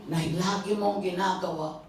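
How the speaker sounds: normal talking voice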